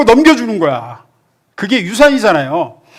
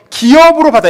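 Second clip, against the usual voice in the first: 기업으로 punched higher and louder; stress on 기업으로; 6 semitones higher, 6 dB louder than usual. Acted. Preacher answering his own question, 기업으로 stressed. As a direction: hit 기업으로 hard, like the answer.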